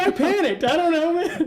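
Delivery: squeaky and giggling, helpless; high laughing excuse. Retold or imitated. Admitting to the friend why he blurted it, high laughing. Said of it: "I was like".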